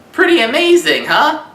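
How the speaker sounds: high and loud, pleased with himself; excited. Real, not acted. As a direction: brag about the howl. Proud after howling.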